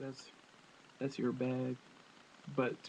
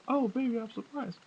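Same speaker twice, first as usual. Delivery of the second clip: mock porn surprise; 8 semitones higher than usual. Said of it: "You're sitting there saying".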